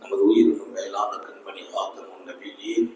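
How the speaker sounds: low, soft, devout supplication; a hushed blessing to close the speech; closing prayer. Acted. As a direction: pray over the audience gently.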